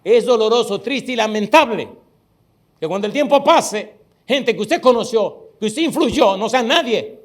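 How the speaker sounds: loud, high impassioned preaching; preaching at full force. Acted. Preacher warning teachers with loud passionate fervor. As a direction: pour it out to the congregation.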